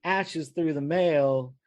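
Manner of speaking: a hint of an unsure clerk drawing it out. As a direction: stall it slowly, up high. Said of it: "she's like".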